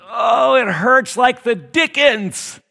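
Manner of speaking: loud, high, drawn-out mock wail of pain; imitated agonized outcry; cries out in pain. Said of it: "she cries out".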